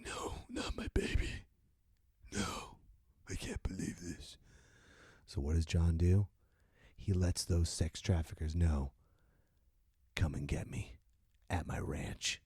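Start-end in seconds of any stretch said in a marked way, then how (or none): 0.0-4.4 whispered disbelief, soft; hushed whispered grief over her body; grieving whisper. Acted. Rambo grieving his dead girl. 10.1-12.4 whispered threat, soft and low; soft whispered taunt to the traffickers; menacing whisper. Acted.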